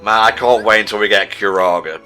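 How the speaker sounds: normal talking voice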